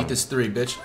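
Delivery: ordinary talk, nothing marked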